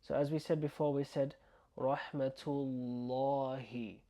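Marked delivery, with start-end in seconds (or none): 1.8-4.0 "rahmatullahi" with a dark, rounded "law"; slow "rahmatullahi", heavy long l-a; heavy Allah sound. Shown as a demonstration. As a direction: stretch "llaa" in "rahmatullahi" with an "or" colour. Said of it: "we're not saying or".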